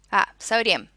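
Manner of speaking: ordinary delivery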